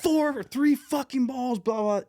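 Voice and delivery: very high, slow mock hollering; imitated coach tirade. Coach cussing out his center fielder, imitated tirade.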